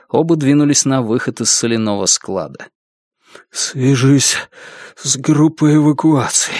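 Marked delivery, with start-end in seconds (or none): 3.5-6.5 gasping, wounded; out of breath. A narrator's reading. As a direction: squeeze the words out between breaths. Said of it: "задыхаясь, проговорил Йона". (задыхаясь)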